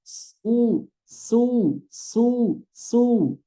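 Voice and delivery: "su" with spread s and rounding only on the u; su with damma. Shown as a demonstration. Teacher demonstrating sin with damma. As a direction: round the lips only for the vowel. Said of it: "Yang monyong domahnya".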